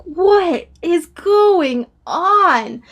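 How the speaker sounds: drawn-out, very slow disbelief; stunned disbelief. Retold or imitated. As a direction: let each word hang in shock. Alone in car, stunned.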